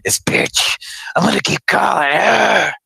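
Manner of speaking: whispered then high and loud; menacing creep. Pretend. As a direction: snarl it like an obsessed creep. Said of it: "You don't wanna ever be like".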